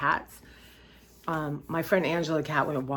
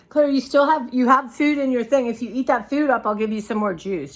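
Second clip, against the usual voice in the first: higher, louder coaxing of a pet; talking to a pet; 6 semitones higher, 7 dB louder than usual. Real, not acted. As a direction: coax the cat to finish eating. Coaxing the cat to eat.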